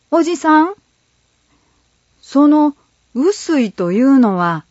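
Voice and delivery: put-on high, curious young boy; lightly voiced boy asking. A narrator's reading. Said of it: "栗太郎くん".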